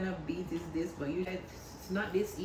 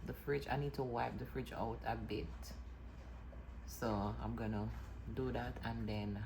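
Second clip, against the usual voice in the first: whispering; 3 semitones lower, 8 dB quieter, faster than usual. Real, not acted. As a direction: hush it, keep it low and soft. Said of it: "so this is why I'm whispering".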